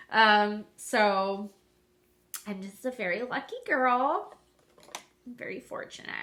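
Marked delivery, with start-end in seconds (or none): 2.5-4.3 sarcastic. Real, not acted. To her viewers about her lupus, sarcastic and bitter. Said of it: "I probably sound like an ass". 5.3-6.1 "I'm very fortunate" breathed out, dripping irony; mean the opposite of "fortunate"; sarcastic. Real, not acted. To her viewers about her lupus, bitter sarcasm. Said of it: "I probably sound like an ass".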